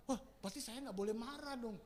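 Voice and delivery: usual speaking voice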